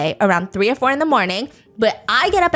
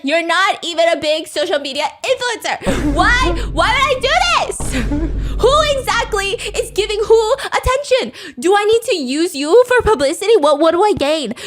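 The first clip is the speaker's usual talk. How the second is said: much higher and louder, indignant; imitating her anger; 8 semitones higher, 3 dB louder than usual. Z raging at Han on livestream, high and loud. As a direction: fire back like an outraged ex. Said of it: "I feel her anger".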